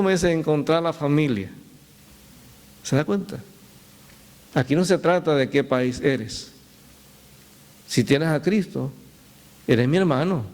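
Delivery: usual speaking voice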